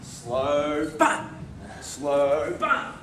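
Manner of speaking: Slow drawn out, then a sudden short but. Shown as a demonstration. Teacher mimicking people starting off slow, then sudden. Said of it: "start off the mark slow".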